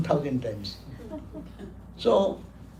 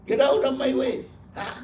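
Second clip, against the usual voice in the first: sharp, raised voice ordering him away; angry monk; 6 semitones higher than usual. Retold or imitated. Monk scolding Alexander blocking his sun, angry. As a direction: snap at the king to move aside. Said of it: "He was so angry".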